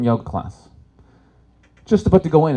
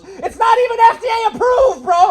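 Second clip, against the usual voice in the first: much higher and louder yell; retold shout; 7 dB louder than usual. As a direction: get in his face and holler it. Another guy confronts him.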